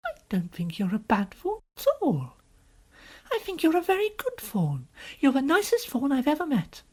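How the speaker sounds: put-on high, earnest child's voice; comforting little girl. Lucy comforting the weeping faun, high and kindly. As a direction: reassure him in a girlish high pitch.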